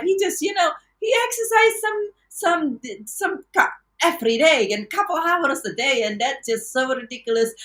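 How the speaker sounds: high, scoffing retelling; mocking own past self. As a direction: pitch way up in disbelief. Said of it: "I'm like".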